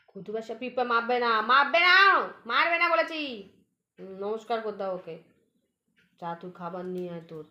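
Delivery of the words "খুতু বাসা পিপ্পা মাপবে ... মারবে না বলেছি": high, loud scolding; loud scolding of a pet; scolding the cat. Real, not acted. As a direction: tell the cat off sharply.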